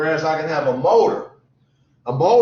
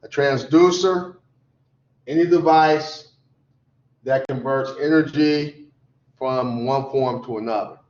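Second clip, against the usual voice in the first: short phrases with long pauses between; slow dictation. Shown as a demonstration. Teacher dictates a definition for students to write down, slowly in pieces. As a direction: dictate slowly, breaking after "transducer", "device" and "energy". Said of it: "So write that down".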